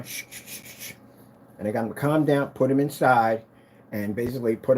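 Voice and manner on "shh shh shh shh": soft unvoiced shush. Calming the aggressive dogs with a soft shush. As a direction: hush the dogs gently.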